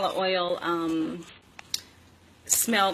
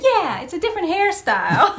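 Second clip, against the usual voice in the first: higher, louder mock agreement; Michael agreeing; 11 semitones higher, 8 dB louder than usual. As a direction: chime in like an amused Michael. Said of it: "And Michael said".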